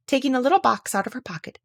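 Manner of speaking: plain reading voice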